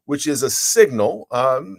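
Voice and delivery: normal talking voice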